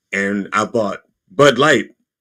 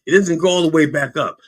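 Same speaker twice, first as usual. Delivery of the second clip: normal talking voice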